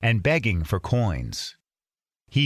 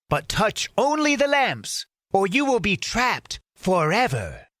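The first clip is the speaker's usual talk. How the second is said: scheming magician; 8 semitones higher than usual. Acted. Magician instructing Aladdin at the cave, high menacing. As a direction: warn him with creeping menace.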